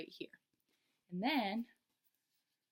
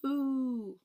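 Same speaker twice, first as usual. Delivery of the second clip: put-on children's loud moo, high; 7 semitones higher, 9 dB louder than usual. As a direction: moo like a cow for the class. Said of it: "they'll all go".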